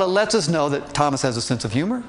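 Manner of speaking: ordinary delivery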